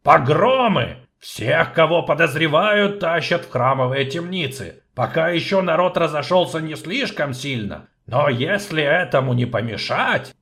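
sudden loud angry bark, slowed; furious bark. Acted. Dwarf barking at the sorceress in sudden fury. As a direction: snap it out in rage.

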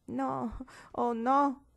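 lightly voiced tearful no; holding back tears. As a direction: fight the tears and barely hold them. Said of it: "sforzandosi di trattenere le lacrime".

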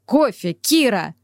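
slow, mildly growled command; growled order. A narrator's reading. Boss orders coffee from his secretary, lightly growled. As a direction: growl it out slowly, understated. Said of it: "рыкнув".

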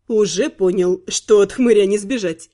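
low male voice with a touch of cheer. A narrator's reading. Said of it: "добавил куда веселей". (чуть веселее, низким голосом)

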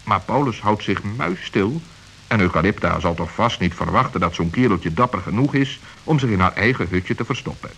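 ordinary delivery

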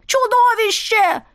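high, slow panicked yell; frantic scream. A narrator's reading. Servant behind the door screams at the monster, high and frantic. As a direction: cry out in terror at the beast. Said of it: "истошно закричал".

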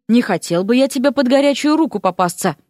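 plain reading voice

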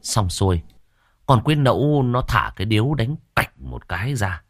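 usual speaking voice

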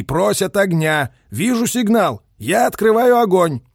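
insistent, high-pitched military report; loud phone talk. A narrator's reading. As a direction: shout into a field telephone, arguing with a superior. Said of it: "громко заговорил в трубку".

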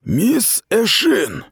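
stern commander. Acted. Aradai lectures the captured girl, cold and official. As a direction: speak as a cold superior officer.

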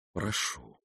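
very soft, low, barely voiced reply; faint with dread. A narrator's reading. Prince answers the proposal question, faint with dread. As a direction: let it out softly, heart nearly stopping. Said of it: "Замирая, ответил князь".

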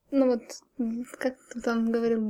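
usual speaking voice

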